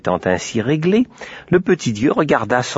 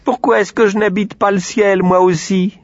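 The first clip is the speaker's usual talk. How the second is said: petit cochon plaintif; 9 semitones higher, 4 dB louder than usual. Acted. Le cochon réclame.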